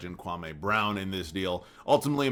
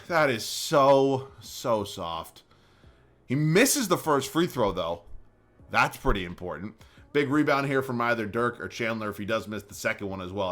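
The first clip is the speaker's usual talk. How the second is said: ordinary delivery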